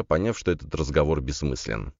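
ordinary delivery, nothing marked

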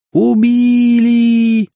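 very high, loud, long-held screech; hysterical shriek. A narrator's reading. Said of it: "истерически завизжала какая-то женщина". (истерический визг)

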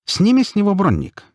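very high-pitched abrupt order; high, urgent command; suddenly roused. A narrator's reading. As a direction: pipe up suddenly with an instruction. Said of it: "неожиданно очнулся Альт".

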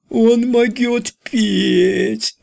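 very high, slow squealing voice; Pickaninny voice. Acted. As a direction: squeal it in a cartoon child voice. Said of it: "заверещал Ричи голосом Пиканини".

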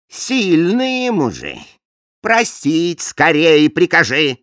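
lightly voiced bossy king.

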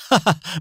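high, short scornful laugh; mocking laugh. A narrator's reading. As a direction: laugh at him down the phone. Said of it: "ضحك داني بتهكم".

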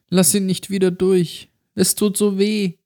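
put-on high, small child's voice in pain; pained little girl. A narrator's reading. The mutilated girl pleads with Mark, high and pained. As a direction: whimper it like a hurt child.

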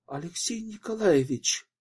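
high, shocked exclamation; acted dismay from a shaken woman; alarmed. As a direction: voice rising in alarm. Shaken widow addresses returning detective.

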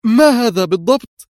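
high, loud, astonished question; startled exclamation. A narrator's reading. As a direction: cry out in surprise. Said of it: "وشهقت هي هاتفة". (هتاف مندهش بصوت عالٍ)

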